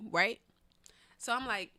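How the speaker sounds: ordinary delivery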